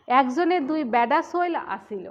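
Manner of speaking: Bogura dialect. Shown as a demonstration. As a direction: say it like a Bogura local, loud. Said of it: "বগুড়ার লোক বলবে তখন কি বলবে".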